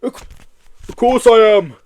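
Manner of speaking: loud high indignant retort. Acted. Yeti retorting indignantly to Joe, loud high retort. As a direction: fire it back offended. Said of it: "retorted the indignant Yeti".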